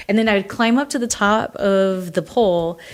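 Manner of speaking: usual speaking voice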